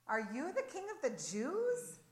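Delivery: humorous, incredulous ruler's question; amused mocking question. A narrator's reading. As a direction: ask it as a joke at the prisoner's expense. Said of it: "Pilate humorously asked him".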